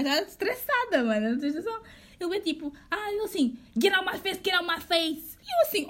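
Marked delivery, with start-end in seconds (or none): 3.8-5.4 raised, higher-pitched retold snapping; heated mock dismissal; mock angry man. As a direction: imitate him telling you off. Retells aggressive man arguing in English.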